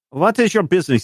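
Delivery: high guard voice in broken French. Acted. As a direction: question him like a suspicious soldier. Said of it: "he says in his broken French".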